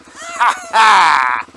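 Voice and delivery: loud gleeful laugh; triumphant laugh. Real, not acted. Triumphant laugh after making it.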